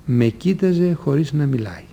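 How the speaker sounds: ordinary talk, nothing marked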